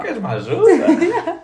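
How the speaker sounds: very high, slow, laughing put-on voice; mock neighbour. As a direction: stretch it out like a goofy helper. Mimics the neighbour's offer.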